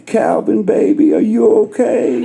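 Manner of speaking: hammed-up falsetto concern. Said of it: "[name] ... outside the door".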